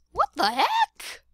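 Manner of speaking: very high, loud squeak; squealed question in a put-on high voice; squeaky disbelief. Third, most exaggerated take.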